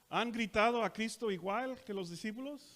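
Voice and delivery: normal talking voice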